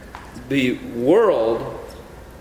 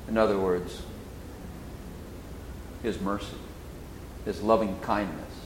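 normal talking voice

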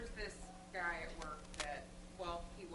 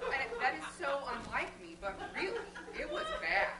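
emphatic; 8 semitones higher, 9 dB louder than usual. Real, not acted. Emphatic laughing confession.

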